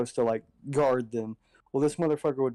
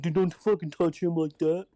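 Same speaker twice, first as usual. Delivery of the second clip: higher, stern protective warning; 5 semitones higher than usual. Retold or imitated. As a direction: step in and defend him.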